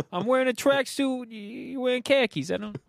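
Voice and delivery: New York kid mocking Florida preps; mock New York accent.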